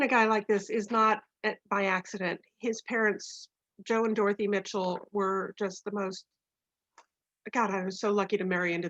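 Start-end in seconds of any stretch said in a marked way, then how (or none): none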